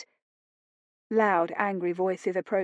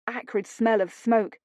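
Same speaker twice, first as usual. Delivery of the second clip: ordinary delivery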